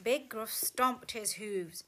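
ordinary delivery

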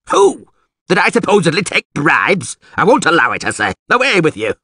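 high, outraged alderman; indignant yelling. A narrator's reading. Alderman denying bribes and dismissing villager, yelling. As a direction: raise the pitch in loud indignation. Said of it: "yelled the alderman".

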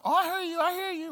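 mock teen blowing off a parent; dismissive kid. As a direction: imitate a child not really listening. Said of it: "You know, not, like".

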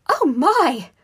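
high, very loud squeal; loud girlish squeal. A narrator's reading. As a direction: squeal in shocked delight. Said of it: "Parvati squealed".

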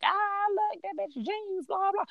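high, squeaky teasing voice; mocking kids. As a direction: imitate catty kids sneering behind a back. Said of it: "laughing at you like".

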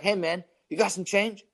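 a hint of a pestering street beggar; mock panhandler. Imitates man begging for change.

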